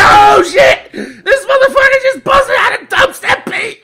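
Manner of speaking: loud, high shout of disbelief; astonished outburst. Retold or imitated. Retelling his shock at the clerk's dubstep speech, astonished shout. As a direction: yell it in amused shock.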